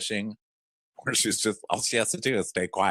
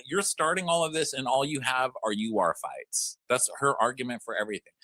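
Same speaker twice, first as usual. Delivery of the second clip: ordinary talk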